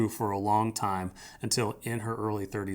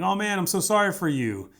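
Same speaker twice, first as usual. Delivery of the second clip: mock sympathy; 8 semitones higher, 4 dB louder than usual. Shown as a demonstration. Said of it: "Sympathy is standing above somebody".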